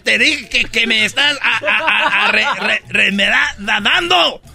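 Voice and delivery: mock furious stammerer; angry stuttering rant. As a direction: stammer harder and yell at the end. Angry stutterer shouts at clerk.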